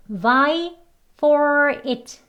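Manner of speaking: VIE, FOR, IT stretched out one by one; separate words. Shown as a demonstration. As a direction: hold each word of "for it" apart. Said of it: "소리가 연결되어서".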